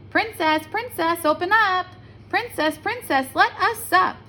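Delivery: sing-song call at the door; frog calling. A narrator's reading.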